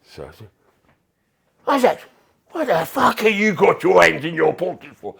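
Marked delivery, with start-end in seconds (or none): 1.7-5.1 high, loud mocking bark of a sergeant major; hammed-up drill sergeant impression; mimicking the coach. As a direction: lampoon the coach's yell at the boy. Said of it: "I, I mimicked him".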